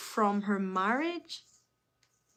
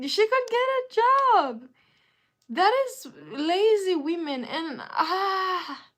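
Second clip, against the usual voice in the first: loud, very high-pitched exclamation; exasperated high yell; exasperated outburst; 9 semitones higher, 8 dB louder, slower than usual. Real, not acted. Venting loudly about lazy women.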